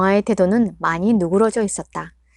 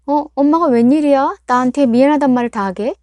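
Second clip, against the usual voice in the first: higher, louder, incredulous question; surprised daughter; 5 semitones higher, 6 dB louder than usual. Acted. Daughter reacts to her mother's first apology, loud incredulous surprise. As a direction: caught off guard, half mocking.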